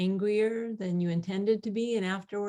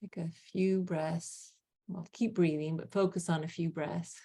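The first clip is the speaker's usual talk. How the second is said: calm grounding exercise. Acted. Leads a grounding meditation.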